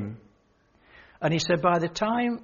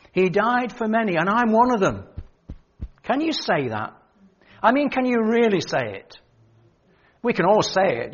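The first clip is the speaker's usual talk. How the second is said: louder, rising preacher's appeal; preaching; 2 semitones higher, 4 dB louder than usual. Acted. Preacher challenges the congregation to say it from the heart, fervent. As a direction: press the question home to the hall.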